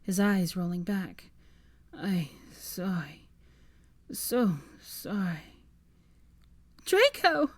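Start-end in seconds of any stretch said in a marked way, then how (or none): 1.9-5.6 fading murmur. Acted. Draco fading, seizing. 6.8-7.5 high, loud shriek of his name. Acted. Hermione shrieks as Draco seizes. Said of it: "Hermione shrieked".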